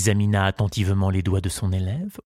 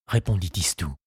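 usual speaking voice

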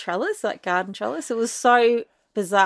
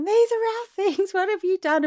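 high-pitched mock outburst; shrill-pitched retold yell, not full volume; hysterical yelling; 11 semitones higher than usual. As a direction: imitate a hysterical protest at shop ladies. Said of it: "I became hysterical and I started yelling".

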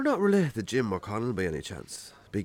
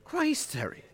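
indignant protest; 10 semitones higher than usual. Acted. Farmer protests blind date setup.

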